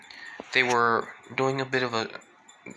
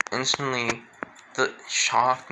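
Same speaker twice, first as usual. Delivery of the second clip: ordinary delivery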